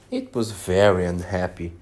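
ordinary delivery, nothing marked

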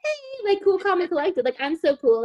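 high, breezy put-on nonchalance; fake casual cool. Girlfriend fakes calm before boyfriend's friend, lightly breezy. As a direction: pretend to be totally unbothered, lightly. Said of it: "So I'm trying to be like".

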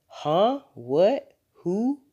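high, rising one-word questions; acted bafflement, each word leaping up; puzzled father caught off guard; startled questions. A narrator's reading. Father startled by cat idea.

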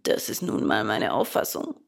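low, calm, husky dolphin; composed croaky creature; unruffled dolphin. A narrator's reading. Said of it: "sagte dieser gelassen".

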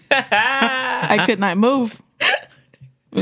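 slow laughing groan. Real, not acted. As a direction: laugh through it slowly. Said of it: "I couldn't move".

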